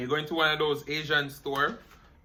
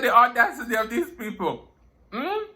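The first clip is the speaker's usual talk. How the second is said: exasperated, pitched up, a little louder; mock outrage at the customers; indignant aside; 7 semitones higher, 3 dB louder than usual. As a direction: scoff at how clueless they are. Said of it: "I said".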